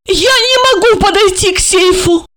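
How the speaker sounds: very high, whimpering female character; moaning confession. A narrator's reading. Olga confessing to Georgy, high moan. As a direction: admit it in a high frightened moan. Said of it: "Ольга застонала и призналась".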